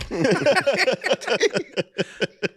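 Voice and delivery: high, long cackling laughter; hearty laugh. Real, not acted. After an Office Space joke, long hearty laugh. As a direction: burst out laughing.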